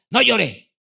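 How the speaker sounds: a hint of a father's curt command; stern father. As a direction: imitate a dad telling off a child. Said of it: "el papá le decía".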